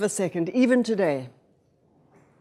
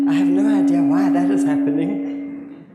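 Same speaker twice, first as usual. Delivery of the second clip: echoey hall sound, voice bouncing back; big hall echo; 3 semitones lower, 4 dB louder than usual. Real, not acted. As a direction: talk into a big echoing hall. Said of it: "I sound very echoey".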